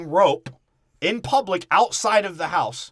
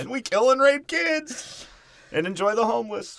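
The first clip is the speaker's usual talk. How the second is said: high, drawn-out local's voice; mock civic boast; 8 semitones higher than usual. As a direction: stretch it up high like a slogan.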